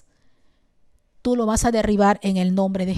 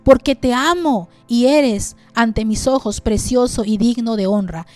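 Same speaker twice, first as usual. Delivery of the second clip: loud, ringing Bible reading over music; fervent scripture reading; 5 dB louder than usual. Acted. Preacher reading Isaiah to his church, loud and fervent. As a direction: proclaim the verses to the church.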